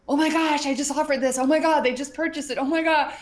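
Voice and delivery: high, loud thrilled squeal; mock giddy client, loud and high; excited client text. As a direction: gush like a client who just made a sale. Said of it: "I get the text messages".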